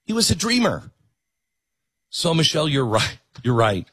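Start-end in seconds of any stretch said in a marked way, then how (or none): 0.1-0.9 higher, mock-innocent punchline; sarcastic reveal. Real, not acted. Host revealing the plotter's status, sarcastic lilt. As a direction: let the irony land high.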